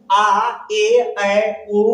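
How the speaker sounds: ordinary talk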